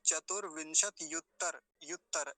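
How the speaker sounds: युत्तर cut short, ending on a bare r; common mispronunciation. Shown as a demonstration. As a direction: drop the final a of युत्तर. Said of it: "यहाँ एक गलती होती है".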